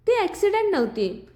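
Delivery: ordinary delivery, nothing marked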